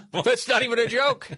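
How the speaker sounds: laughing. Real, not acted. Host insisting to co-hosts it's no joke, laughing. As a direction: say it while cracking up.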